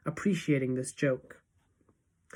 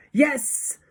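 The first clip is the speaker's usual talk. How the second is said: high, loud single burst; Grand High Witch thundering; 10 semitones higher, 5 dB louder than usual. A narrator's reading. Witch leader confirming her plan, high thundering cry. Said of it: "thundered the Grand High Witch".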